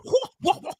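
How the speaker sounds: sudden high-pitched burst of laughter. Real, not acted. Commentator cracking up at the fighter, high laugh. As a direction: let a squeaky laugh escape.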